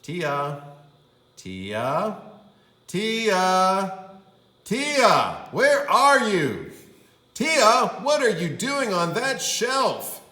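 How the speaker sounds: worried dad shouting; calling out. Acted. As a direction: search the store calling her name. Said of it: "He said".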